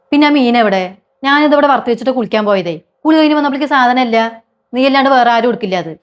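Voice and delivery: high, loud furious accusation; yelling at the sister-in-law over the fish; angry shout. Acted. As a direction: shout her down, certain she took it. Quarrel over missing fish.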